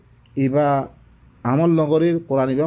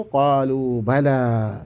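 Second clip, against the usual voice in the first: Quran recitation; 3 semitones lower, much slower than usual. Acted. Recited reply of the people.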